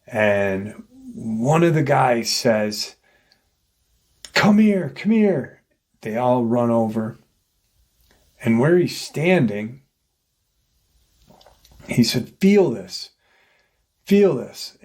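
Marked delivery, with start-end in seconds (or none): 4.3-5.6 high mimicked beckoning shout; retold urgent call. Searcher calls the group over to a spot, retold urgent call. 12.4-14.8 high, insistent mimicked command; retold urgent urging. Searcher urges friends to feel the spot, retold urgent urging.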